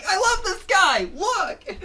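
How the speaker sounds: giddy cartoon fan voice; squealing fan. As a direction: gush like a starstruck fan. Fan squeals over Fango.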